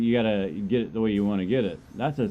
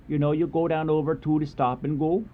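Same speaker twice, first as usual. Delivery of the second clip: put-on Yooper accent, pitched up; 4 semitones higher than usual. Shown as a demonstration. Streamer showing viewers the accent used for a voiceover, Yooper accent. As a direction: show off the Yooper accent for fun. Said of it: "What's it, Youper? They call it Youper?".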